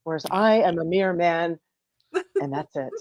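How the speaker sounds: soft, hushed adoring words; whispered worship of wife; tender praise. Pretend. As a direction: murmur it to a goddess. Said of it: "you turn to her and you go".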